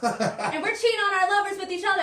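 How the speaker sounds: imitating a tipsy teacher, high and laughing; giggly teacher confession. Retelling a teacher's bar confession.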